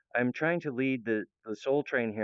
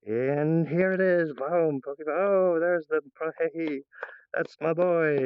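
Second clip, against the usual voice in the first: higher and louder, proud hype; excited cheering; 6 semitones higher, 4 dB louder, slower than usual. Pretend. Mock cheering his own cartoon.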